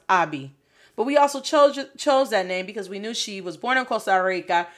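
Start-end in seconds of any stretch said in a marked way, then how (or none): 0.1-0.5 Abi said AH-bee, open first vowel; Hebrew pronunciation. Shown as a demonstration. Parent explaining the Hebrew name, Abi as AH-bee. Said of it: "it's pronounced".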